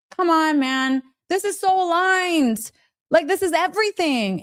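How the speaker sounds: ordinary delivery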